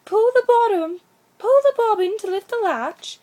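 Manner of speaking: very high, loud put-on granny voice; wolf squeaking sweetly to sound like an old lady; wolf faking Grandmamma. A narrator's reading. Wolf calling through the door as grandmamma, high and loud. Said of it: "he sounded very like Grandmamma".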